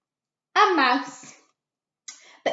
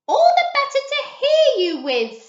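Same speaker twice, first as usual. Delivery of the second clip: sly grandma; 8 dB louder than usual. A narrator's reading. Wolf as grandma in story.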